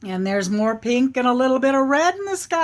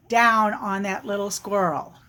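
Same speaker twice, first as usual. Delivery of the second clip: ordinary delivery, nothing marked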